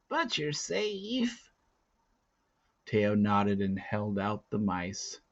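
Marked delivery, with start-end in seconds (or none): 0.1-1.4 put-on high, slow old-woman relief; relieved old woman. A narrator's reading. Kala telling Teo he is safe, slow high voice. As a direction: let the old woman breathe out her relief slowly.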